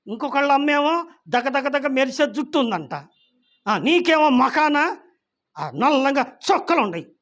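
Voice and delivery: put-on a hurt girl blurting out her grievance; upset child. Daughter complains to mother about her scarred face, upset and loud.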